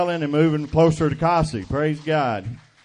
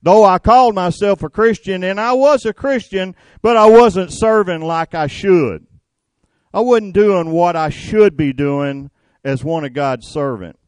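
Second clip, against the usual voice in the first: louder confession, pitch up; fervent preaching; 4 semitones higher, 6 dB louder than usual. Real, not acted. Preacher confesses to congregation, loud and fervent. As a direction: drive the confession home.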